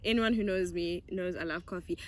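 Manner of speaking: ordinary delivery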